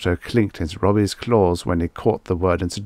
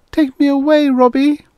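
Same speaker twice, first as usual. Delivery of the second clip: put-on very high, loud child's plea; begging little girl; 7 dB louder than usual. A narrator's reading. Little girl asks robot to take her away, begging. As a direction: beg like a frightened small child. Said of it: "The little girl begged".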